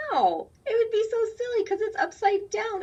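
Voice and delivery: high, loud mock-silly squeal. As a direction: play up how silly it would be. Teacher mock-silly about upside-down book.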